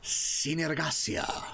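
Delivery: slow, whispery accented name; ASMR read. Shown as a demonstration. Presenting the new world to arriving raiders, whispery ASMR read. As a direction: breathe the name out slowly with an accent. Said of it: "almost my A S M R read on that".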